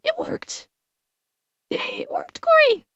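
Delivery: high squeal of relief; excited squeal. Real, not acted. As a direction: squeak it out, overjoyed. TTS alert finally works.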